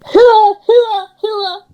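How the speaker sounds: high, loud drawn-out call across the water; fish runner's cry. Retold or imitated. Old Cornish huer crying out that fish are coming in, loud high call. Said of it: "They used to shout that".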